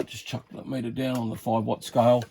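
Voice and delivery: ordinary talk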